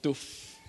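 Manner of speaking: a drawn-out, soft dull thud noise; imitated thud. Drill hitting solid rock, imitated as a soft thud.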